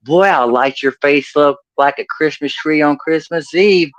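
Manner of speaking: mock Okie old-man bluster. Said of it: "the more redneck and oaky I'm gonna sound".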